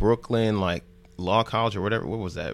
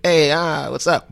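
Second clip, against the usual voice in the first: higher, louder mock greeting; punchy, pitched-up put-on excitement; 6 semitones higher, 4 dB louder, slower than usual. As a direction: imitate an oddly upbeat rapper saying hello. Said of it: "like, oddly excited".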